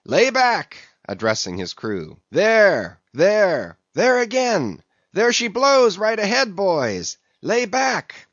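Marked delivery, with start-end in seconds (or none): 0.0-0.8 high, loud rowing command; called order. A narrator's reading. Boat officer addresses his crew, calling out. 2.3-8.3 high, urgent whale-sighting cries; excited calling out. A narrator's reading. Officer spots the whale and urges his crew, excitedly calling.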